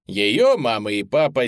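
ordinary delivery

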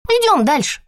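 put-on high firm little girl; pulling a child along; bossy child. A narrator's reading. Girl drags Ruthie along. (властный детский голос)

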